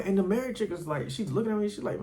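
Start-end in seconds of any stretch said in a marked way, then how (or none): none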